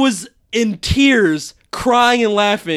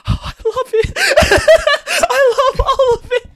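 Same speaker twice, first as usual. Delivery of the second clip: high-pitched, laughing gush; 3 dB louder than usual. Retold or imitated. Host recalling the whole movie, gushing in high voice. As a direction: imitate yourself overwhelmed with love. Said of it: "I was just like".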